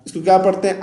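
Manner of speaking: normal talking voice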